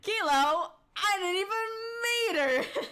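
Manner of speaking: loud, high, drawn-out joke delivery; hammed-up punchline. Streamer landing a kilometer pun, hammed-up punchline. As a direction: belt out the pun, stretching the end.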